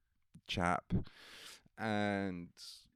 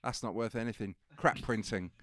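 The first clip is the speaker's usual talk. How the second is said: loud scornful brush-off; dismissive Bailey; 9 dB louder than usual. Retold or imitated. Imitating Bailey dismissing the cheap book, scornful. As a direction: wave it away with contempt.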